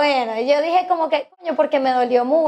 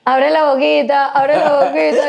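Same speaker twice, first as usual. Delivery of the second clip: put-on coaxing baby talk, a bit louder; feeding a baby; 3 dB louder than usual. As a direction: coax it like spoon-feeding a toddler. Coaxing blindfolded guest to taste.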